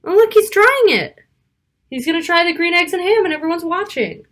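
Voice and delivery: usual speaking voice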